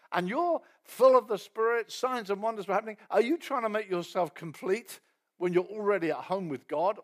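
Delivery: loud, rising indignant rebuke; furious Paul. Retold or imitated. Paul rebuking the Galatians, loud and indignant. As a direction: scold them in disbelief. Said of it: "And he's furious".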